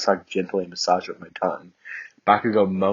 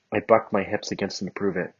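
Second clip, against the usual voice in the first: ordinary delivery, nothing marked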